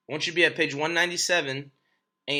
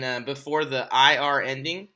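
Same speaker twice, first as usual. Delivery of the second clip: ordinary delivery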